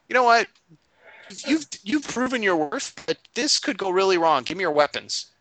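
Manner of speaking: starts high and sharp, then settles into a demand; suspicious villain. Retold or imitated. Sinrith turning on the Dragonborn PC, mock commanding. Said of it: "So Sinrith is like".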